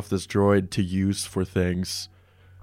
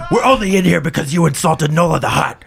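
Bothan character voice; 7 semitones higher, 4 dB louder than usual. Acted. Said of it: "he goes".

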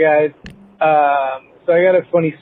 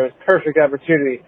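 usual speaking voice

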